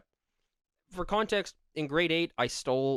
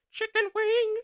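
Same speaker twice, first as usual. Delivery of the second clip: very high, loud sustained falsetto; sing-out squeak to crack a friend up; silly squeal; 5 dB louder, slower than usual. Retold or imitated. Friend mimicking the teacher to make a classmate laugh, silly shriek.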